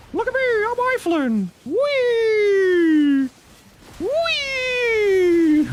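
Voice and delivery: giddy high creature voice gushing; high squeaky cartoon critter. Holds silly high character voice.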